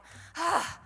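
mock frazzled sigh; exasperated sigh. Shown as a demonstration. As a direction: sigh again like it's all too much. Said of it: "that noise that we all do".

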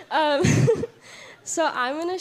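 giggling through the words; nervous laughing. Real, not acted. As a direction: laugh nervously as you speak.

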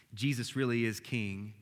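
normal talking voice

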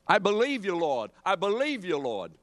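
imitating a fervent believer calling out; mock fervent profession. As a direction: proclaim it like an eager believer.

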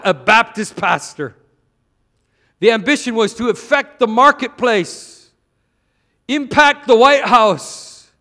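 loud, rising preacher's cry; forceful preaching. Acted. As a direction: preach it hard to the crowd.

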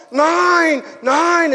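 high mock-child yell; imitated screaming kids. Five-year-olds push him over to the edge, yelling. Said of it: "they just kept screaming at me".

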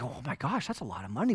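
soft, low awed murmur; hushed amazement. Retold or imitated. Couple adding up years of giving: soft awed murmur. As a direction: breathe it out in wonder.

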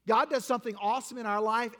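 usual speaking voice

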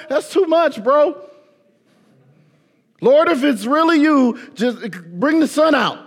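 high, loud pleading, hammed-up; a hammed-up complaint to the Lord; mock whiny prayer. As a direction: beg God to make it stop.

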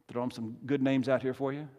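ordinary delivery

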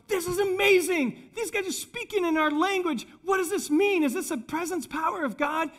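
high, excited mock-crowd voice; amazed. Crowd marvelling at the disciples speaking their language, amazed. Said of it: "They continued in amazement".

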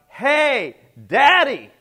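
put-on high, loud little boy's happy shout; child yelling out. Little boy spots his father at preschool, loud happy shout. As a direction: call out to Daddy across the room like a small boy. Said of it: "one little boy that yelled out".

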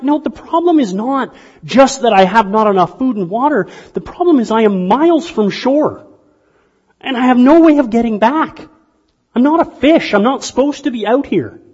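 high, loud, pleading insistence; frustrated castaway. Retold or imitated. Stranded castaway pleads with his rescuers, loud and frustrated. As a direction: insist they are missing the real problem.

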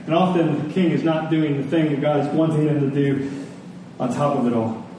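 ordinary talk, nothing marked

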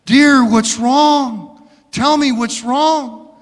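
a hint of an anxious mom, drawn out; mother anxiously asking her daughter; worried mother. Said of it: "The mom said".

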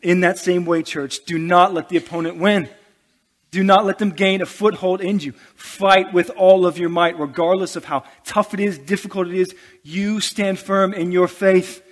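rally the church like a coach; fiery exhortation. Real, not acted. Pastor urging the church to stand firm, full-force preaching.

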